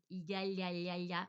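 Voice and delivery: ya repeated with a liquid ly glide; Spanish ll drill. Shown as a demonstration. Showing learners the elle sound, ya with a ly glide. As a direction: run 'lya, lya' together on each ya. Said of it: "and then the elle".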